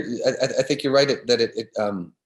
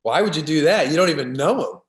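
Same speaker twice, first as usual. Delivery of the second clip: loud, higher mock disbelief; incredulous challenge; 5 semitones higher, 5 dB louder than usual. Actor's buddy challenging an adoring fan, incredulous. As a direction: imitate a buddy indignantly questioning a fan.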